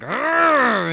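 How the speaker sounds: high, loud "arrgh"; villain's growling roar. Acted. Boss grabbing the player, loud acted roar. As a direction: roar as you grab your victim. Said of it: "and he's like".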